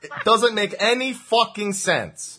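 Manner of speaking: loud, high Jersey rant; angry Jersey accent. Acted. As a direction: let the Jersey out in a drawn-out angry outburst. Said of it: "when I get angry, it really comes out".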